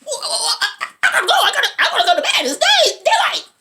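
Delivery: high, loud, laughing panic; villain panicking at the daylight, high and laughing; mock panic. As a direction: play the vampire's frantic fear. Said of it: "He's like".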